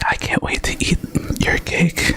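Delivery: breathy close whisper; ASMR whisper. Acted. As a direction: whisper right into the mic. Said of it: "in A S M R voices".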